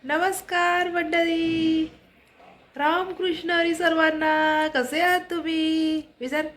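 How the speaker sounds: high, drawn-out sing-song hello; sing-song greeting. Real, not acted. Vlogger greets her viewers, high and sing-song. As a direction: greet them in a high, lilting voice.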